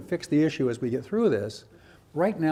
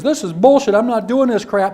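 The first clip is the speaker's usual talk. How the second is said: loud, higher defiant outburst; a kid snapping back, loud and higher; 4 semitones higher, 9 dB louder than usual. Retold or imitated. Teacher imitating an angry student, loud defiant outburst. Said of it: "You'll get the kid who kinda goes".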